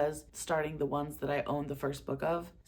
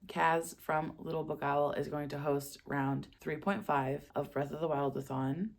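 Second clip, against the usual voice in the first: ordinary talk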